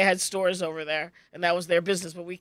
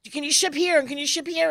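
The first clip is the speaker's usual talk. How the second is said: pestering customers; 7 semitones higher than usual. Retold or imitated. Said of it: "everybody's just".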